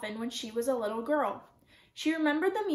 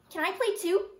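lightly voiced, higher child's plea; eager little child; 6 semitones higher, 3 dB louder than usual. A narrator's reading. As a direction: ask to join in, pitched up. Little sister begs to join game.

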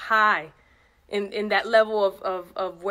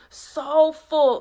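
SO FULL called out loud and high; all caps; 6 semitones higher, 5 dB louder than usual. Shown as a demonstration. Reading a text aloud in shouted capitals. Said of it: "in all caps".

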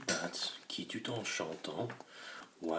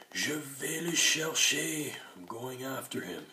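brave cry; 7 semitones higher, 6 dB louder than usual. A narrator's reading. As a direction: announce it bravely. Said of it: "cria James".

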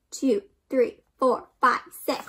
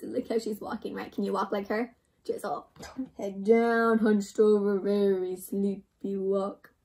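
ordinary delivery, nothing marked

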